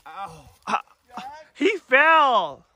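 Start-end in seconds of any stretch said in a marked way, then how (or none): 1.5-2.7 alarmed report. Real, not acted. Reporting brother's fall.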